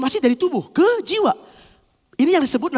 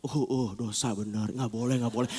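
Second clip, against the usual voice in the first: very low, hushed repetition; murmured, obedient agreement; meek agreement; 12 dB quieter than usual. Acted. Soul accepting the warning, meekly hushed.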